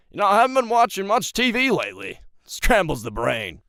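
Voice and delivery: high, loud character voice; Lucifer. Acted. Streamer doing a requested cartoon character, high loud impression. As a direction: pitch it way up and project, sliding down at the end. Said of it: "Do a good Lucifer voice".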